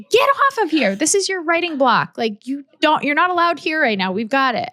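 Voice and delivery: high-pitched, indignant team voice, easing off at the end; mock telling-off. Team catches the boss in Slack during writing block, mock telling-off. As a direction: scold like exasperated staff shooing the boss away. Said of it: "they get mad at me".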